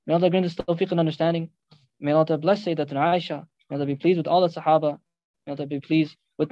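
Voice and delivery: preacher's closing supplication; closing prayer. Acted.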